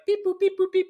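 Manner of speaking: high chirpy beep boop noises; beep boop sounds. Shown as a demonstration. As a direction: beep it out like little steps. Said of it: "like that".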